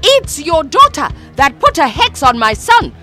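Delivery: high, loud retort; countering. A narrator's reading. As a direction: throw the blame back. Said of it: "countered Mama".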